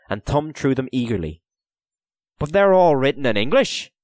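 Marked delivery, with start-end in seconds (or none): 2.4-3.9 louder, higher note of disappointment; disappointed. A narrator's reading. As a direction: protest with open disappointment. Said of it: "he said in disappointment".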